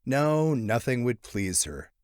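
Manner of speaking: plain reading voice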